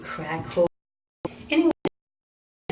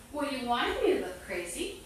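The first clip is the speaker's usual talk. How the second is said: normal talking voice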